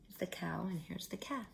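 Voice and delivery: soft near-whisper naming the animals; whispered aside. Real, not acted. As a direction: point and hush. Pointing at picture, aside.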